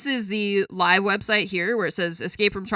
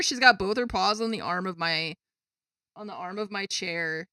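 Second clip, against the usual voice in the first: ordinary talk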